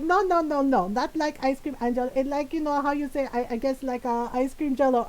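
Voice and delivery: put-on insistent Filipino-accented mom, halting; mom's Filipino accent. Mom correcting her child, in her Filipino accent. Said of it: "in my mom's voice".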